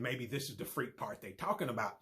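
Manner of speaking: ordinary delivery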